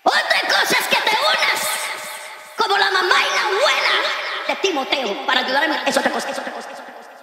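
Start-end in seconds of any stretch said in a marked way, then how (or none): 0.0-3.9 high, shouted point; preaching shout. Real, not acted. Pastor shouting a point to the congregation. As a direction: hammer the point home.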